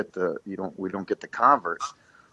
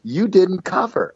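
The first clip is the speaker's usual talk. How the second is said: higher, louder, slowed retold yell; angry coach; 11 semitones higher, 5 dB louder, slower than usual. Head coach yelling at his assistant on the sideline, angry. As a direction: bark it like a furious coach. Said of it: "he said".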